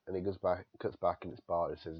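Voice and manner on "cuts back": ordinary delivery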